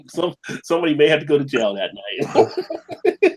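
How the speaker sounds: talking through real laughter; amused, words broken up by laughs; laughing. Real, not acted. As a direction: chuckle through a teasing aside. Joking about jail, laughing.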